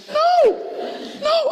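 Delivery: very high, loud panicked "no, no"; terrified little boy. Retold or imitated. Little boy panics at the thought of stitches, very high and loud. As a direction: cry out in a small boy's panic. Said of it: "I'm thinking".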